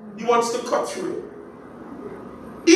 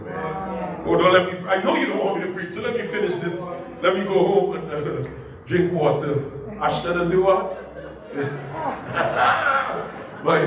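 usual speaking voice

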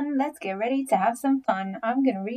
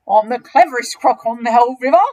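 loud, proud declaration; enormous crocodile, boastful; 11 dB louder, faster than usual. Acted. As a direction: puff it up like a bragging croc.

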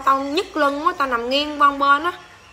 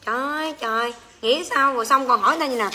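usual speaking voice

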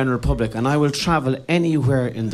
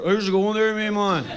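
lightly voiced Donegal greeting, a touch higher; Donegal accent; 5 semitones higher than usual. Comedian imitating Donegal locals, Donegal accent. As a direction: mimic a Donegal local saying hello. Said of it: "everyone kind of said".

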